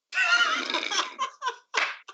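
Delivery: high laughing shout. Real, not acted. GM cracking up at the table, big laughing shout. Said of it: "GM laughs at own line".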